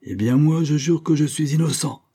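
high, emphatic declaration of innocence; vehement protest. A narrator's reading. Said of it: "s'écria Bethel avec véhémence". (protestation véhémente)

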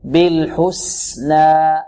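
slow بالحسنى with the س allowed to flow; sin given full softness. Shown as a demonstration. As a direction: let the hiss of س run on in بالحسنى before ن. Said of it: "حقها من الرخاوة".

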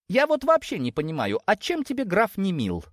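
high, puzzled pleading voice; exasperated parent. Acted. Parent asking daughter what is wrong with the count, exasperated. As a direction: press the question, pitched high.

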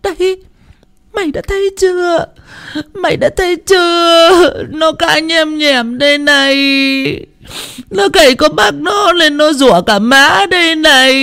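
high, shrieking accusation with sobs; bawling accusation. Acted. Mother-in-law accusing her daughter-in-law to her son, high and wailing. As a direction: screech and point the finger. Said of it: "mồm vẫn cứ ngoạc cả ra".

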